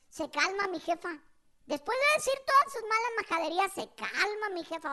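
ordinary talk

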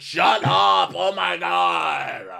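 high, loud mock yell; imitated fed-up outburst; exasperated shout. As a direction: bellow over someone's screeching, dragging it out. Acted shouting match.

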